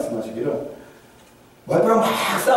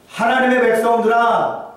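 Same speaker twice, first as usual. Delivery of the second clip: rousing call; 7 semitones higher, 3 dB louder than usual. Acted. Gamaliel addressing the noisy council.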